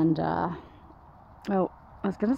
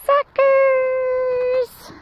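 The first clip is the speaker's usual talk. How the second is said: high, loud gloating tease; teasing; 13 semitones higher, 6 dB louder, much slower than usual. Real, not acted. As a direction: rub it in playfully to the dogs. Teasing dogs who fell for trick.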